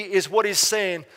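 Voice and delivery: ordinary talk, nothing marked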